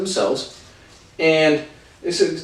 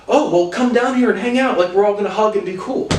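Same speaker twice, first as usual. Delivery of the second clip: higher, sing-song mock invite; mocking fake friendliness; 7 semitones higher than usual. Mocking the cousin's fake friendly invite, sing-song. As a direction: parody a smug cousin being nice. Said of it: "he goes".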